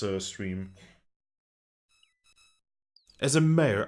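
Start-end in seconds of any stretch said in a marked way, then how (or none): 3.2-3.8 louder, higher official proclamation; mock civic grandeur; booming mayor. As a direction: announce it like a pompous mayor. Rereads mayor line louder.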